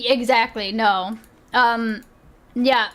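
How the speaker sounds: normal talking voice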